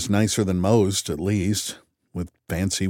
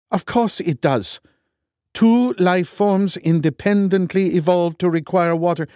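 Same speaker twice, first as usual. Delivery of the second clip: higher, louder Norwegian contempt; scornful Norwegian; 10 semitones higher, 4 dB louder than usual. A narrator's reading. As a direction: sneer it in a Norwegian accent. Loken arguing.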